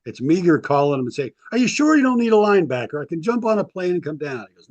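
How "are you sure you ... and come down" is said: eager plea. Retold or imitated. Retold eager phone plea.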